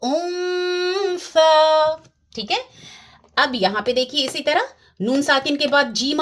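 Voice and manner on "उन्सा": slow chanted उन्सा with a long nasal hold; held ghunna recitation. Shown as a demonstration. Quran recitation demo, nasal hold.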